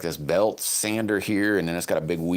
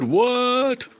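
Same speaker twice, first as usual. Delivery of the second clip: much higher, louder, slowed-down hype voice; high, loud, stretched-out mock announcer shout; hyped-up greeting; 12 semitones higher, 4 dB louder, much slower than usual. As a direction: call it out like an announcer welcoming George. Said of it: "Matt Greedy said".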